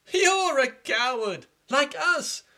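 high, slow jeering voices; children jeering at the older prince; mocking children. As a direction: taunt him in a high sing-song. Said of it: "children mock the prince".

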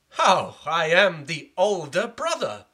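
loud, slow indignant protest; angry elder prince demanding the throne. Acted. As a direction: insist on his birthright. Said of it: "prince protests his claim".

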